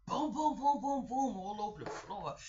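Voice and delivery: mock booming stomps; stomping imitation. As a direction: thump out the neighbors' noise. Imitating upstairs noise.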